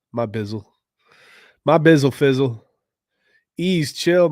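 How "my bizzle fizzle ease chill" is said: louder, hyped-up burst; sudden excited outburst; got too excited. Real, not acted. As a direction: blurt it out all fired up. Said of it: "Sorry, I got too excited".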